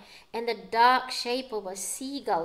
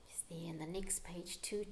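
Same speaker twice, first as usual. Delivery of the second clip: soft whispered aside; 5 semitones lower, 13 dB quieter, faster than usual. Real, not acted. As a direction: hush it to the little listener. Aside to child while reading.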